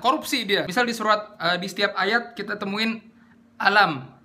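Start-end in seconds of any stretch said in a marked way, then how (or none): 3.5-4.2 "alam" with a clipped first vowel; half-beat hamzah. Shown as a demonstration. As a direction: rush the "a" of "alam" into the lam. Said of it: "ini setengah harokat".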